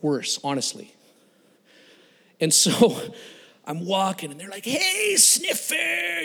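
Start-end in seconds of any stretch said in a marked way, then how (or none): 4.7-6.2 high, drawn-out mocking holler; taunting call. Gang taunting a glue sniffer, high jeering call. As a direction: jeer it across the street, pitched way up.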